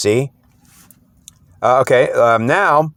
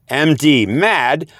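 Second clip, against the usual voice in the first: usual speaking voice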